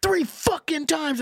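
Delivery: hammed-up furious customer; mock angry customer. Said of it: "he started being like".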